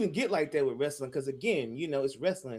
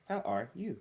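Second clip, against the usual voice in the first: ordinary delivery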